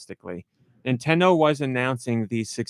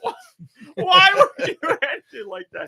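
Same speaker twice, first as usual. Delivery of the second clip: high, wailing mock despair; mock anguished wail. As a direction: wail it like a betrayed fan. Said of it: "And I was like".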